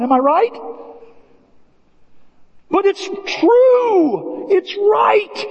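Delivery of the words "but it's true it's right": high, slow, impassioned preaching; fervent preaching. Real, not acted. Pastor urging his church to keep believing, impassioned and slow. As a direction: drag it out, pleading with the church.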